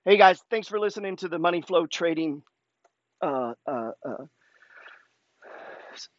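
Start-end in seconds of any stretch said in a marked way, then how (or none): 3.2-6.1 choking mid-sentence, words stuck. Real, not acted. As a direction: stumble as if the throat catches. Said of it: "Sorry, I'm choking there".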